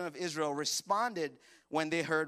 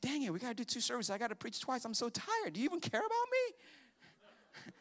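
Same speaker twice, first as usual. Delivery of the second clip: fast, higher whiny grumbling; mock complaining; 5 semitones higher, 4 dB quieter than usual. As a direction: grumble like a tired pastor.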